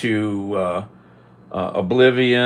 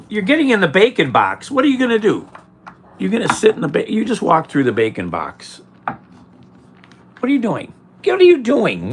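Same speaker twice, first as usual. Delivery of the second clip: louder and higher, scolding; scolding the cat; 5 semitones higher, 4 dB louder, faster than usual. Real, not acted. Telling off the cat walking through the bacon box, loud and high. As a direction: shoo the cat down sharply.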